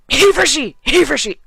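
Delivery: high, very loud rapid slogan shout; mock outburst. Breaking off the argument to heckle, mock outburst. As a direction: blurt it out like an uncontrollable tic.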